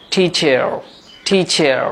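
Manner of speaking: টিচার with a clearly sounded final r; American pronunciation. Shown as a demonstration. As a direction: say টিচার ending in a full "er". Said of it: "র সাউন্ডটিকে তারা পরিষ্কারভাবে উচ্চারণ করে".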